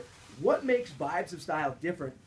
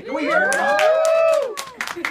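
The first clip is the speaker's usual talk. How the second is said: long, much higher, much louder drawn-out shout; calling for applause; 17 dB louder, much slower than usual. Real, not acted. Host calls the studio crowd to cheer, shouting. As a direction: hype the crowd like an MC, stretching it out.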